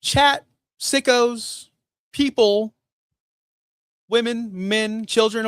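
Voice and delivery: calling out to chat. Real, not acted. Calling out to viewers.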